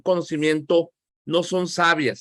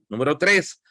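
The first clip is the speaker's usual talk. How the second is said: ordinary talk, nothing marked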